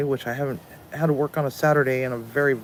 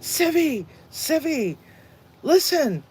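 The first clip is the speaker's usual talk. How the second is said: high called-out Civvy, Civvy; inmates calling out; 13 semitones higher, 4 dB louder, slower than usual. Retold or imitated. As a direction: call after her from across the hall. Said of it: "the guys are like".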